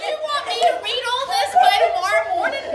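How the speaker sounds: loud, high disbelieving shout; outraged outburst. Retold or imitated. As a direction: protest loudly in comic disbelief. Acted outraged reaction to reading load.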